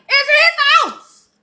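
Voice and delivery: high, slow, stretched-out mocking voice; slow, high-pitched taunt; mocking sneer. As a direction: parody his claim with a drawn-out sneer. Mocking the man's claim.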